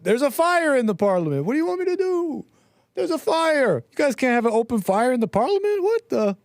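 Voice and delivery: high outraged put-on voice; indignant official. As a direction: play a shocked politician, squeaky. Voicing the MP's excuse.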